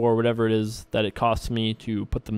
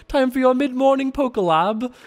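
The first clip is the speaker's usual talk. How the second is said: put-on a botched, much higher stab at a UK accent; failed UK accent; 11 semitones higher than usual. To viewers in the UK, a half-hearted UK accent. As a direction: put on a UK accent and let it slip. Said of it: "I wasn't trying to do a U K accent, but I kinda sort of was".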